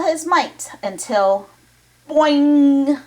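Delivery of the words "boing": boing. A narrator's reading. Sound effect in story.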